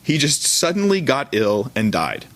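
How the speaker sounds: usual speaking voice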